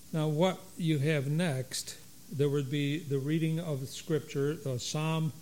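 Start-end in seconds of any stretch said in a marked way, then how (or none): none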